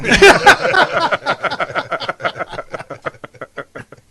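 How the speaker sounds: loud long laughter; hearty laugh. Real, not acted. Comedian laughing loudly at his own joke's ending. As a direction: laugh hard at your own punchline.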